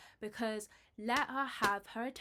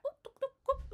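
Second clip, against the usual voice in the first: much higher, clipped oop calls; mock driver urging horses. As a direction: hurry the horses with high yelps. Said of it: "he's probably giddy up".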